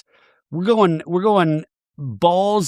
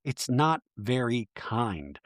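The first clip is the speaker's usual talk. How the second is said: much softer, slow and wounded; hurt and quiet; 3 semitones lower, 10 dB quieter, much slower than usual. Retold or imitated. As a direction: say it barely above a breath, hurt. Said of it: "and Amanda goes".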